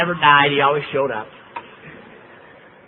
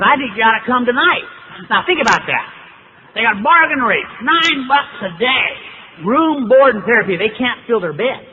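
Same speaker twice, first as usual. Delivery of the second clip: worked-up rant; 7 semitones higher, 5 dB louder than usual. Real, not acted. Ranting about the high-pressure sales.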